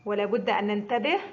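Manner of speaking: ordinary talk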